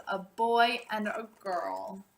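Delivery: usual speaking voice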